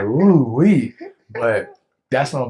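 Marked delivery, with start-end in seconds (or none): 0.0-0.9 loud, high whoop of relief; overcome with emotion. Retold or imitated. Man feeling the emotions while telling his story, overcome. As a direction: let the feeling burst out in a whoop. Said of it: "I felt the emotions. I'm like".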